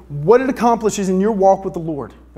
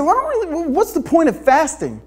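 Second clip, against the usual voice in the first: lightly voiced know-it-all friend, pitched higher; a hint of a smug know-it-all; smart guy; 8 semitones higher than usual. Preacher voicing smart Jeff's reply.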